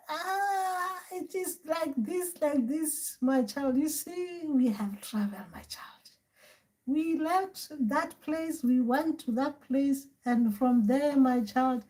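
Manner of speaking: high, soft, slow elder voice; acted granny, drawn-out and quavering; great-grandmother spirit. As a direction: explain it slowly as an old ancestor to a child. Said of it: "So, grandmother, great grandmother".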